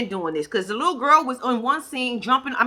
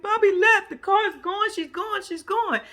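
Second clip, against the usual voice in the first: whiny Taylor; 9 semitones higher, slower than usual. Retold or imitated. Voicing upset Taylor's quote.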